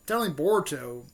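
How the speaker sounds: normal talking voice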